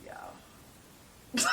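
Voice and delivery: breathy unvoiced aside; whisper. Real, not acted. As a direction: whisper it to the audience. Confiding aside to audience.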